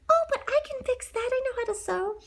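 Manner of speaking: high sweet heroine. Acted. Cinderella voiced high.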